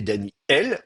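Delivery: "Daniel" as Dani-El with blocked air; glottal stop. Shown as a demonstration. Teacher offers the careful option, glottal stop in "Daniel". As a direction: cut "Daniel" in two before el. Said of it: "en bloquant le passage de l'air".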